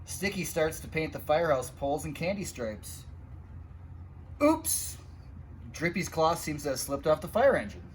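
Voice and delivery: ordinary delivery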